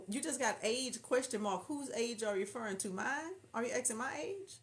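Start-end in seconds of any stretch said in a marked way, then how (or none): none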